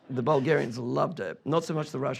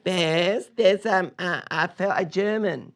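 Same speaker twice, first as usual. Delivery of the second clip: high, loud mock mum calling out; hammed-up call from a mum; Bill's mum; 5 semitones higher, 5 dB louder, much slower than usual. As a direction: call out like a flustered older mum, pitched up. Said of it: "then Bill's mum goes".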